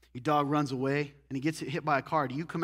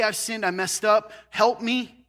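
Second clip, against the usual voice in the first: higher, louder, slow pleading cry for help; pleading sinner; 6 semitones higher, 9 dB louder, slower than usual. Pretend. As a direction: mock-desperate plea to be let back in. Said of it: "They even say".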